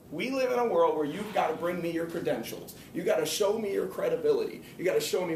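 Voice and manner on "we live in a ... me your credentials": ordinary talk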